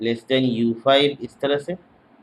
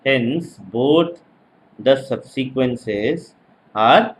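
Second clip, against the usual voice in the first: slow, spaced-out lecture dictation; 2 semitones lower, much slower than usual. Real, not acted. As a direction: dictate phrase by phrase for note-takers. Dictating for students to write.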